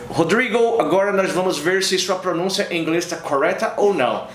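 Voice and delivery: mock American accent in Portuguese; foreigner's accented Portuguese. Gringo YouTuber offering to check Rodrigo's pronunciation, American-accented Portuguese. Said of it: "o cara falou um português perfeito".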